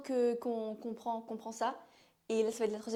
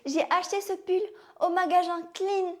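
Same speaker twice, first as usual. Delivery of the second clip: higher, louder, hammed-up retort; playful defiance; 6 semitones higher, 9 dB louder than usual. Answering a friend who mocks her accent, playful defiance. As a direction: play it up loudly, pitch raised.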